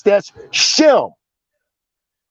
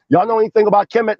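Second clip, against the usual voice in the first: quick question; faster than usual. Retold or imitated. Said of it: "watch when I say".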